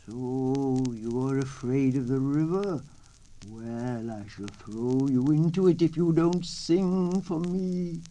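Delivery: slow, loud gruff coyote; coyote threat. A narrator's reading. Coyote threatening to throw the turtle in the river. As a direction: menace him slowly.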